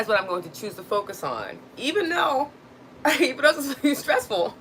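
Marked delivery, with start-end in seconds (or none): 1.8-4.6 high, laughing through stress; nervous laughter. Real, not acted. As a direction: laugh it off, pitched up. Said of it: "I'm really stressed out right now".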